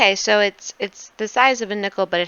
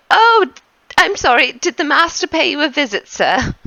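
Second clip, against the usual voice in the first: higher, louder startled servant; gasp, then fuss over him; 7 semitones higher, 4 dB louder than usual. Acted. Servant Anton apologizes and asks about the master's visit, high. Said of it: "and Anton goes".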